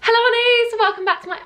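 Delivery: high, loud opener that falls away; put-on vlog welcome, breaking off; forced intro greeting. Shown as a demonstration. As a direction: start big and cheery, then abandon it. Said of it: "my voice doesn't sound the same".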